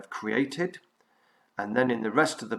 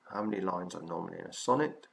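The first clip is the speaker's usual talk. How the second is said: brisk, run-together question; fast, lower-key restating of a question; quick repeat; 3 semitones lower, 4 dB quieter, faster than usual. Real, not acted.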